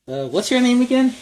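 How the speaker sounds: cluelessly asking his partner's name; confused hero. Acted. Amnesiac Hawkeye asks partner's name.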